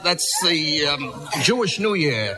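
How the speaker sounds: ordinary talk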